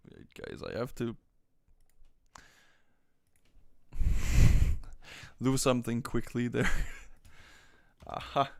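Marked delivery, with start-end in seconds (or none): 3.5-5.4 big breathy cackling laugh; loud burst of laughter. Real, not acted. Laughing hard at stream chat, loud. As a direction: let a loud laugh explode out.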